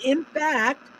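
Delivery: usual speaking voice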